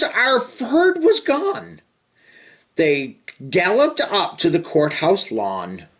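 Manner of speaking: lively sing-song storybook reading; reading to children. Acted. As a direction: read the rhyme to little kids with bounce. Reading picture book to children.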